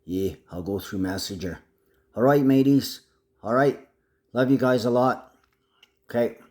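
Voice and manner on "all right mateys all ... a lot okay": higher, louder Aussie sign-off; Aussie accent. Pretend. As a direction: call goodbye like an Aussie mate. Aussie-style sign-off to friends.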